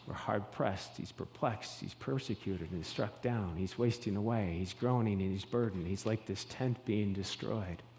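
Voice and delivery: quiet heavy lament; hushed lament. Acted. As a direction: let the burdens weigh the voice down. Paul's troubles, hushed.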